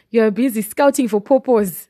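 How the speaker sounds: ordinary talk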